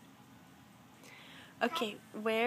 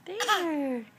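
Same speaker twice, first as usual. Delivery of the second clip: put-on reading to a toddler; much slower than usual. Parent talking to toddler at puzzle.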